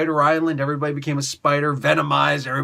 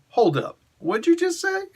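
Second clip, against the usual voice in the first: rising, offended "excuse me?"; indignant challenge; 13 semitones higher than usual. Retold or imitated. Blade cutting off the slayer, indignant. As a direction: Blade bristling at the insult, pitch jumping up.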